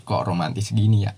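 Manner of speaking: usual speaking voice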